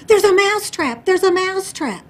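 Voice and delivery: high, loud panicked cry; shrieked warning, acted out; horrified mouse. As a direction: shout the alarm like a terrified storybook mouse. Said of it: "The mouse is horrified".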